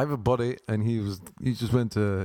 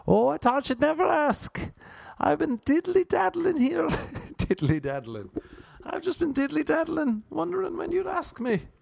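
giddy woman voice. Pretend. Woman answering the chat-up.

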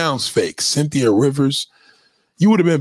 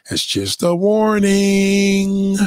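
higher, held long on WARNING; drawn-out warning; 4 semitones higher, much slower than usual. Real, not acted. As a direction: stretch "warning" out on one high note. Drawls out the warning teasingly.

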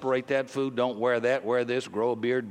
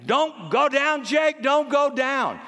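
high, loud, slow drawn-out yell; team yelling; 11 semitones higher, 4 dB louder, slower than usual. Retold or imitated. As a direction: bellow it across the field like the whole team. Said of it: "The whole team starts yelling at him".

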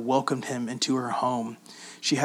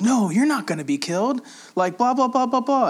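mocking Peter's protest; 10 semitones higher, 3 dB louder than usual. Peter rebuking Jesus, mocked.

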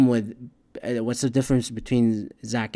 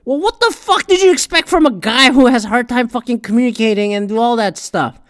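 higher, louder, fed-up yell; indignant shouted retort at the TV; exasperated rant; 11 semitones higher, 8 dB louder than usual. Retold or imitated. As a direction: blow up at the show in disbelief. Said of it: "I just go like".

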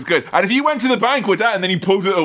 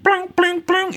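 high imitated "blink" plinks; light high-pitched cartoon plinking; sound effect; 14 semitones higher, slower than usual. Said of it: "the nice sound effect of".

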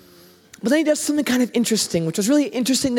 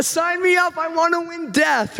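mock eager sign-up; 6 semitones higher than usual. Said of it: "no one's like".

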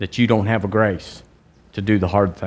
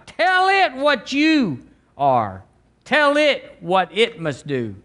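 prophetic proclamation; 6 semitones higher than usual. Acted. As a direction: proclaim it as God's own word, pitch raised. Tongues, then prophesies as God's voice.

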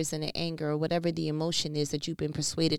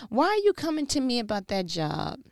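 God asking; 10 semitones higher than usual. Acted. Preacher voicing God to a worried worker, high and pleading. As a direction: let God's question soar. God's question at sermon peak.

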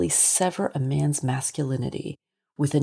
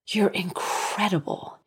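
put-on slow, breathless old man; panting; 4 semitones higher than usual. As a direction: gasp it out, panting. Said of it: "he panted".

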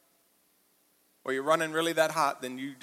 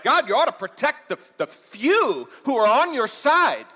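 mocking entitled plea; 7 semitones higher, 8 dB louder than usual. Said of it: "and you're like".

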